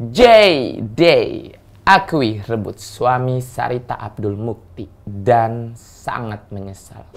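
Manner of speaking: very slow, drawn-out announcing; portentous, slow reveal; dramatic headline read. Acted. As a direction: stretch each word like a gossip-show headline. Reads headline in presenter style.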